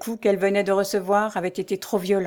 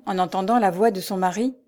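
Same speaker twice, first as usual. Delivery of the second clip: ordinary delivery, nothing marked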